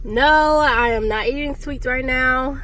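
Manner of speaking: a loud, mock-stern no to her own craving; firm self-refusal. Real, not acted. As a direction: put your foot down on the sweets. Firmly refusing herself the donut.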